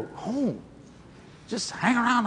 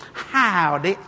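high, long-drawn Southern greeting; lady's slow, lilting howdy; drawling friendly lady; 4 semitones higher, much slower than usual. Retold or imitated. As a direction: mimic her stretched-out, high-pitched hello. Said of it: "That's how she talked".

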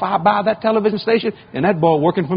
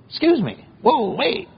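mock customs agent; 7 semitones higher, slower than usual.

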